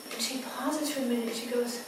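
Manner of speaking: usual speaking voice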